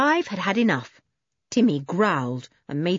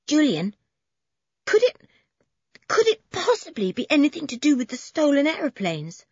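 a burst of eager excitement, pitch jumping higher; sudden excitement; 9 semitones higher than usual. A narrator's reading. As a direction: blurt it out as the idea strikes. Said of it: "with sudden excitement in her voice".

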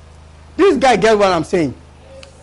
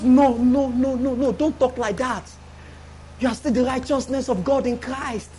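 hushed, gentle Holy Spirit voice; soft whispering reassurance; 6 dB quieter than usual. Acted. Holy Spirit answering the one who sinned, soft reassurance. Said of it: "he whispers to you".

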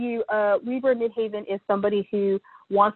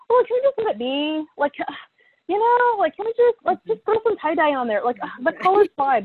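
dreamy carefree voice; 8 semitones higher than usual. Retold or imitated. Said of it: "fights with the Pisces sun".

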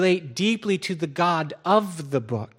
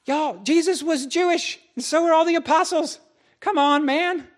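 much higher, pleading disbelief; exasperated; 12 semitones higher than usual. Real, not acted. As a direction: plead with them, incredulous. Exasperated at racist claim.

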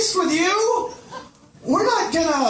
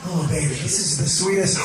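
low seductive voice; 10 semitones lower than usual. Retold or imitated. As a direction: croon it low like a lover. Mock lover voice again.

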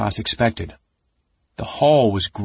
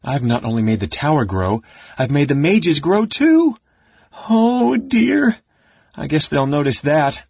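climbing into a high, loud squeal of dismay; high-voiced alarm, easing at the end; aghast kender; 6 semitones higher, 4 dB louder than usual. A narrator's reading. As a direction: pitch soars as the realization hits. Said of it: "Taz gasped".

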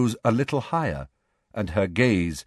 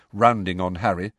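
ordinary delivery, nothing marked

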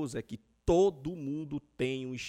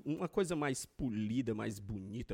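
mock charming; 7 semitones lower, slower than usual. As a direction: drawl out the compliment sweetly.

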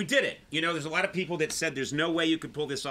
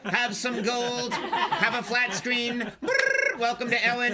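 high, slow gushing welcome; sing-song like a fawning greeter; overly cheery host; 6 semitones higher, slower than usual. Retold or imitated. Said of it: "And they're all like".

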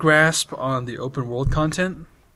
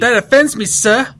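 hammed-up indignant, louder and higher; mock offended; 10 semitones higher, 12 dB louder than usual. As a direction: play outraged gentleman. Mock outrage at anti-tea friend.

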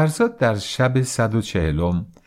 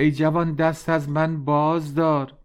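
higher, pleading cry of a beaten man; mock cry for mercy; 6 semitones higher than usual. A narrator's reading. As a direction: call out to the young hero, begging. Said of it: "فریاد برکشید که".

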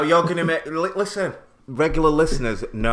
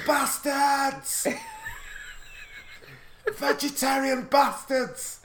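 much higher, slow screeched insult; high mock screech; much slower than usual. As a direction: squeal it like a cartoon madman. Acted yelling as running away.

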